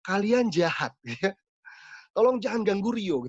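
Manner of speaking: lightly voiced pleading woman spirit; a spirit in a woman's voice begging them to stop. Possessed youth pleads with the praying pastor in a woman's voice. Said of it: "Itu dengan suara wanita".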